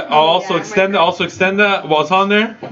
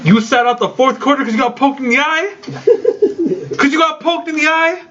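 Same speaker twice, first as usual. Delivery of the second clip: higher, half-laughing rant at a player; laughing mock rant; 7 semitones higher than usual. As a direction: scold him, cracking up as you go. Ranting at injured player, laughing.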